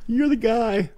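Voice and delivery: high falsetto fan voice; comic high-pitched gushing; starstruck squeak. Retold or imitated. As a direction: squeak it in giddy awe. Starstruck retelling, laughing.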